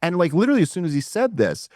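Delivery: normal talking voice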